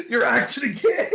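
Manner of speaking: loud, high and cracking up; laughing realization. Retold or imitated. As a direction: blurt the realization through laughter. Stunned realization, retold while laughing.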